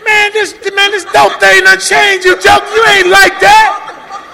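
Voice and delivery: high, loud, slow insulting yell; retold angry shout. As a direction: hurl the insult at the snitch. Retold quarrel with his friend.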